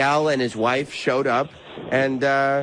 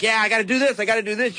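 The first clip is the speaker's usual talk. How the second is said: higher-pitched, pumped-up mimicry; fired-up resolve; 8 semitones higher, faster than usual. A listener won over by Kevin's ideas, mock fired-up. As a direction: act out sudden eager conviction. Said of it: "all of a sudden you feel like".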